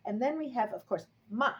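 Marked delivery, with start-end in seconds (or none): 1.3-1.5 "ma" short, loud, falling sharply; fourth tone. Shown as a demonstration. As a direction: drop "ma" sharply from high. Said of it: "very sharp and goes down".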